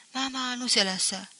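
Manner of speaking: ordinary delivery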